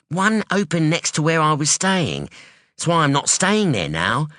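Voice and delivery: a sharp, wary daughter; hint of a guarded young woman; young woman Anyi. Anyi voiced in story.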